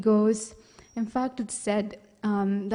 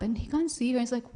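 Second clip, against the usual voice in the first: ordinary delivery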